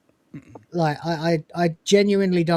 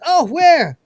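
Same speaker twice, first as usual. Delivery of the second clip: high, louder panicky cry; alarmed cry; 10 semitones higher, 8 dB louder than usual. Retold or imitated. Cleaning droid told of a smudge, high alarmed cry. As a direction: squeal in fright at the thought of dirt. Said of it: "He goes".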